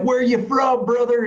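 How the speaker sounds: high, hyped-up bro question; stoked dude imitation. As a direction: imitate an excited stranger greeting a bro. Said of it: "dudes were getting stoked about it".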